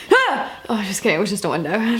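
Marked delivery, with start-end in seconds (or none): 0.1-0.6 short, high pretend scream; mock startled yelp. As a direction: yelp as if suddenly frightened, playfully. Fake scare at the window.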